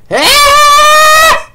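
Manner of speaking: long, high, loud whoop; triumphant scream. Real, not acted. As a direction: let out a drawn-out celebratory yell. Repeats the celebrating reaction.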